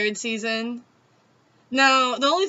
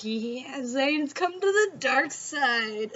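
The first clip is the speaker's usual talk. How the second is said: mock dramatic villain; 3 semitones higher, slower than usual. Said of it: "I'm like".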